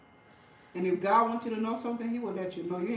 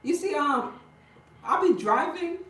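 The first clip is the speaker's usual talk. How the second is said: higher, louder, eager telling; excited; 7 semitones higher, 6 dB louder than usual. Real, not acted. Telling viewers about driving, excited and loud. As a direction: bubble over with it.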